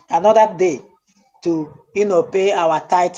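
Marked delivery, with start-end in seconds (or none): none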